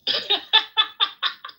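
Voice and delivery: squealing high laugh; high burst of laughter. Real, not acted.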